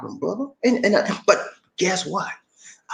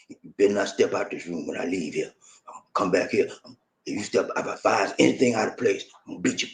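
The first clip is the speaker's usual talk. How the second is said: put-on domineering good old boy; 10 semitones lower, 8 dB quieter, faster than usual. Mocking a controlling husband's boasts to his woman, in a low mock tough voice. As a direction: play the bossy husband laying down the law, way down low. Imitating threatening abusive man.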